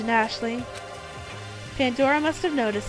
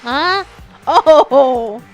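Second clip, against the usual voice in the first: startled gasp; 4 semitones higher, 16 dB louder than usual. Acted. Shocked at bracelet poison.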